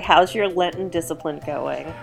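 ordinary talk, nothing marked

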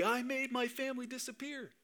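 high, soft put-on movie-kid voice; Kevin McAllister. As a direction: imitate a film kid, high and hushed. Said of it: "Kevin McAllister running through the airport".

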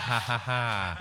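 ordinary delivery

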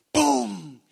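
loud, drawn-out falling boom; imitated thud. Storyteller imitates the noise heard at the well, loud boom. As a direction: boom it out like a sudden crash. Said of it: "de repente escuchamos un ruido".

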